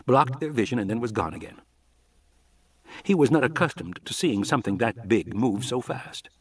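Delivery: plain reading voice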